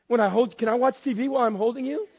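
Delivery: high-pitched comic request; tentative bargaining. Acted. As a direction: ask hopefully, angling for permission. Acts out hopeful question to wife.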